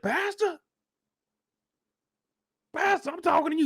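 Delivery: mock plea to get the pastor's attention; high-pitched mock call; imitating the man calling out. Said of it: "he said".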